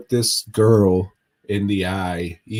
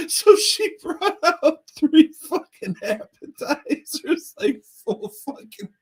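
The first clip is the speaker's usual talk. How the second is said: laughing. Real, not acted. Laughing while telling the mixup.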